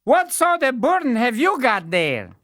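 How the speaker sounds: high, loud simpleton; the fool. Acted. Fool questioning the weakling, high and loud. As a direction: ask with cheeky curiosity. Fool's voice.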